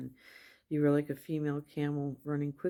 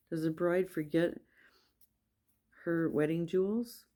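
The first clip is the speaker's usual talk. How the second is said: ordinary talk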